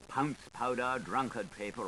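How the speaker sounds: plain reading voice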